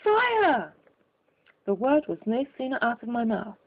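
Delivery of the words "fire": high, loud alarm cry; cry of fire. A narrator's reading. Narrator tossing the rocket, yelling loudly to the room. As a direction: raise the alarm with a yell. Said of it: "with a cry of".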